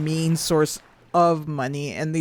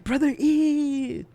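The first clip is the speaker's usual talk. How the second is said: high, drawn-out "ew" on "Brother ew"; mock disgust, stretching "ew" up high; imitated meme disgust; 9 semitones higher, much slower than usual. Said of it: "this guy's like".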